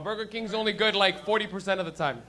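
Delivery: usual speaking voice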